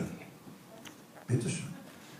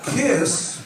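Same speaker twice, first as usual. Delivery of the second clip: band name punchline; 12 semitones higher, 4 dB louder than usual. Acted.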